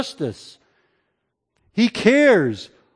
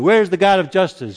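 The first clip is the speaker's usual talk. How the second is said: ordinary delivery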